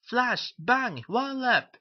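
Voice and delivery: high, punchy shout, last word stretched; excited shout. Acted. Thomas shouting as the photographer takes the picture, excited. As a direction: call it out high and gleefully. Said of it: "shouted Thomas".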